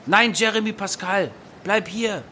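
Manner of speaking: high urgent call. Acted. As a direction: call him back in alarm. Calling a friend back. (hoher, dringender Zuruf)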